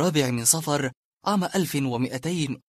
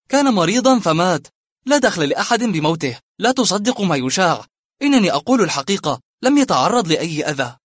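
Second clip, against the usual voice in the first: raised, insistent denial voice; defensive Suleiman; 5 semitones higher, 8 dB louder than usual. Acted. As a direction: protest your innocence, a touch higher. Suleiman denies the rumours.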